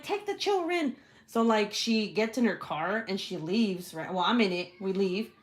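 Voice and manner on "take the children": high-pitched melodramatic plea; mock panicked plea. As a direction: plead as if fleeing disaster. Retold plea to flee fire.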